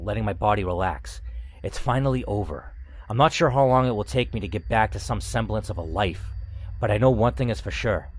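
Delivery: steady, even read-aloud narration; story narration. A narrator's reading. Reading the game's final chapter aloud to viewers, calm narration.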